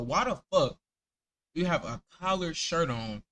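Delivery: ordinary talk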